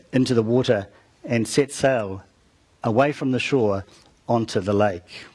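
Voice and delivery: ordinary talk, nothing marked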